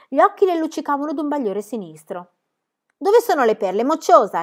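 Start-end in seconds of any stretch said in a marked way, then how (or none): 3.0-4.4 a snarled, louder demand; growling. A narrator's reading. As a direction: snarl at the brat. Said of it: "ringhiò Book".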